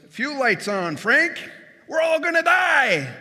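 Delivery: rising into a high, loud panicked shout; panicked co-pilot. Retold or imitated. Co-pilot yelling to Frank, panicked high shout. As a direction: yell it like the plane is going down.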